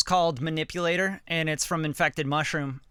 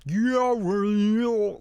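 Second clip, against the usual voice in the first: pitch-bending glide; 5 semitones higher, much slower than usual. Shown as a demonstration. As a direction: voice bends up high and slides. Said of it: "it can bend".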